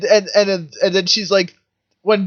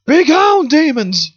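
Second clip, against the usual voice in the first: high, loud, slow mock cry; mock shout; 11 semitones higher, 6 dB louder than usual. Crowd spotting the hound demons, loud mock shout. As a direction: bellow it like a panicked crowd.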